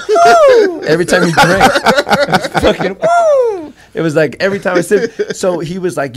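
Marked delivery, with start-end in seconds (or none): 0.1-0.8 very high, loud "woo"; imitated swirling whistle. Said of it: "my whistle was swirling". 3.0-3.7 very high, loud "woo"; imitated swirling whistle. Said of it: "my whistle was swirling".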